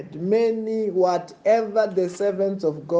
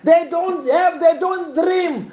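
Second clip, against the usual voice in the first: fiery preaching; 11 semitones higher, 6 dB louder than usual. Acted. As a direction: roar the blessing over the crowd.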